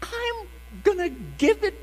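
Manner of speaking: high, desperate pleading; pleading with baby. Retold or imitated. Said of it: "And I said".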